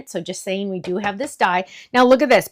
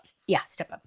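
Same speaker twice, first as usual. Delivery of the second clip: whisper; 6 semitones lower, 10 dB quieter than usual. Real, not acted. Checking the die name to herself.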